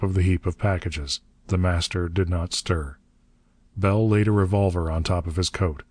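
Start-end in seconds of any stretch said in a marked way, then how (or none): none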